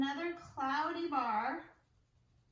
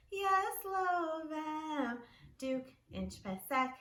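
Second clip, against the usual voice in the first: ordinary talk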